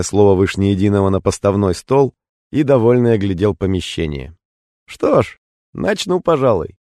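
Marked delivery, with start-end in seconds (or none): 4.9-6.8 high, relaxed Tuma voice. A narrator's reading. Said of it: "нараспев принялся читать он".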